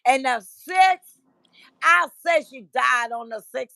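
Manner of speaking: lightly hammed-up complaint; mock indignant. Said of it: "And then he says".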